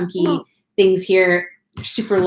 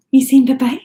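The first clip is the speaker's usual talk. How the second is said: higher, louder sing-song question; 5 semitones higher, 5 dB louder than usual. Real, not acted. Mom asking her son if he says bye-bye, bright baby talk.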